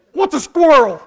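loud, high, bewildered yell; mock alarmed child. Scared child questioning dad, loud alarm. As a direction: blurt it like a panicked kid. Frightened child asks father.

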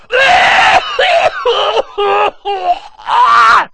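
high, loud choking cry; imitated coughing gasp. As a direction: fake a long hacking gasp. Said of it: "I hear Christy going".

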